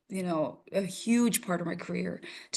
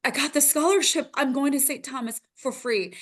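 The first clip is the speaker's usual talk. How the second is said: excited crying announcement; 6 semitones higher than usual. Retold or imitated. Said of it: "I was like".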